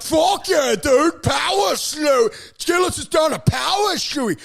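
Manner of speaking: high, loud mock fan shouting; hyped crowd imitation. Imitating fans thrilled by the stunt, hyped shouting. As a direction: yell like an overexcited fan.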